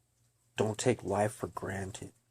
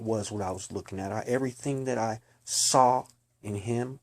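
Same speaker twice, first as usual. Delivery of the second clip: loud, urgent preaching voice; fervent testimony; 5 dB louder than usual. Real, not acted. Witness tells listeners about seeing darkness, loud fervent preaching. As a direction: proclaim it like a warning from the pulpit.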